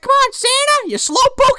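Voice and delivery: very high chiding voice; mock nagging. Fairy hurrying Santa on, high mock nagging. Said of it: "She's like".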